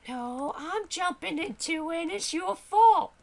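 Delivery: indignant high-voiced sidekick; accusing sidekick voice. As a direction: throw the blame back in a high voice. Character blames the player.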